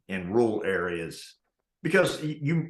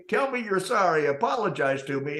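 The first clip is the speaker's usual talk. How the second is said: much higher mock demand; demanding customer; 9 semitones higher than usual. Customer insisting on an apology, mock high demand. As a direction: lampoon him insisting. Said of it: "he kept telling the guy".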